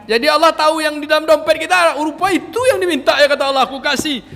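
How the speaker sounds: high, loud and fast playful telling; excited. Real, not acted. Teacher jokes with the mothers that God will grant it, excited. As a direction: bubble over with joy, laughing at the end. Excited joking about God's reply.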